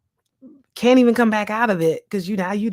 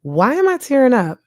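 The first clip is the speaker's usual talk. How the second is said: higher and louder, catching herself; surprised at herself; 5 semitones higher, 5 dB louder than usual. Retold or imitated. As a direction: stop short, startled by your own tears. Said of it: "I'm like".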